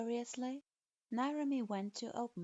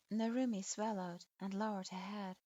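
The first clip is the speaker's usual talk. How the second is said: ordinary delivery, nothing marked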